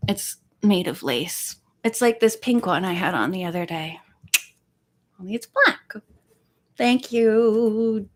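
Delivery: ordinary delivery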